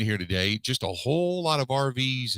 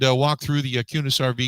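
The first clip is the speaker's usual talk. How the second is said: normal talking voice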